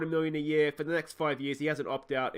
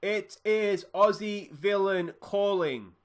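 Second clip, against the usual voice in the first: put-on slow, louder and higher, spelled out for an old man on the phone; speaking up slowly; 5 semitones higher, 4 dB louder, much slower than usual. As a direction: say it slowly and loud so a deaf old man hears. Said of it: "I need to speak up".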